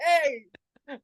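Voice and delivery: high, chirpy mock cheer; cheery call. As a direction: toss off a cheeky thumbs-up greeting. Colleague's thumbs-up call.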